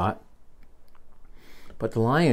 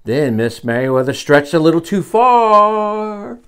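rising, louder, last word stretched out; building suspense; 9 semitones higher, 10 dB louder, slower than usual. A narrator's reading. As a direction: climb towards a drawn-out disaster. Story suspense before the fall.

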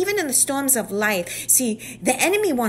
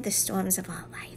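whisper; 2 semitones lower, 9 dB quieter than usual. Real, not acted. Whispered emphasis in sermon.

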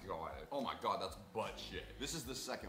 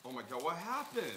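shocked; 6 semitones higher, 3 dB louder than usual. Real, not acted. Shocked at ruined donuts.

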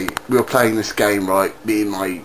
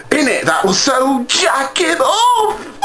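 mock exclaim; 12 semitones higher, slower than usual. Mocking exclaim after secret.

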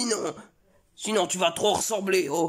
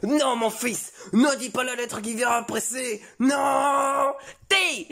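father's rising mock shout, higher at the end; mock yell; 8 semitones higher, slower than usual. Father stopping his son from saying the letter, hammed-up shout. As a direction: cut the son off with a hammed-up cry.

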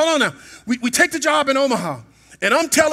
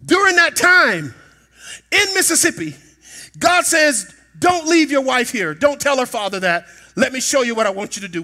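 full-force preaching; 3 semitones higher, 5 dB louder, slower than usual. Acted. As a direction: preach it with rising fire, stretching the words.

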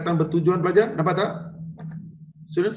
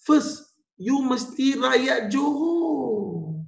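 voicing the Sultan; 8 semitones higher, much slower than usual. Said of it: "Baginda kata".

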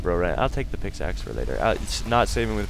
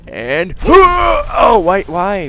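gasp, then a high, loud cry of disbelief; outraged gamer shout; shocked shout; 13 dB louder, much slower than usual. Real, not acted. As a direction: yelp as the plan collapses. Door trick fails.

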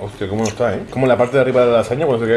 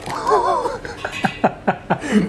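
high giggle; 13 semitones higher, 4 dB quieter than usual. Real, not acted. Laughing at own portion.

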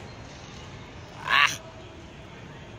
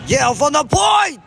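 higher, loud play-by-play call; excited call; 6 semitones higher, 6 dB louder than usual. Real, not acted. Commentator calling a shot from the point, excited shout.

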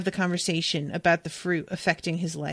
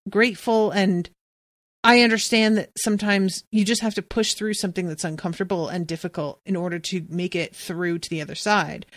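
normal talking voice

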